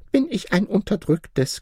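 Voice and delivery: ordinary delivery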